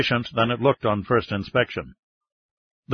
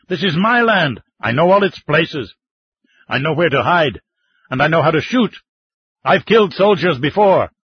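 higher, louder claim to the land; defiant old man; 4 semitones higher, 4 dB louder than usual. Acted. Old man to the mining chief, proud raised defiance. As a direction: stand firm on your own ground.

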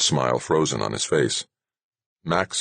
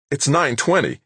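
sudden high yelp of alarm; 11 semitones higher, 3 dB louder than usual. A narrator's reading. Nick startled by the late hour, yelping. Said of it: "he yelped".

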